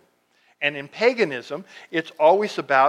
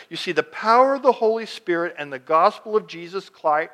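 normal talking voice

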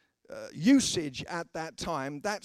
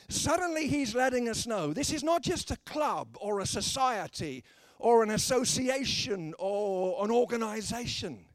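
ordinary talk, nothing marked

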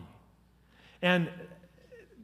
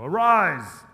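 loud, dramatic "Arise"; mock grand "Arise!"; booming command; 4 semitones higher, 11 dB louder than usual. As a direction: call it out like a showy healer. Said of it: "put his hands up and go".

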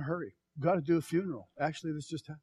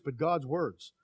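ordinary talk

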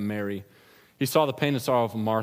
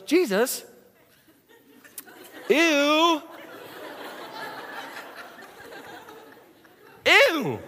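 disgusted Martha; much slower than usual. Pretend. Martha recoiling at the tomb's smell. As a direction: squeal in comic disgust.

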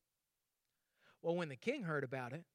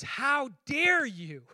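indignant rebuke; 2 semitones higher, 4 dB louder than usual. Retold or imitated. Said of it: "God's perspective is".

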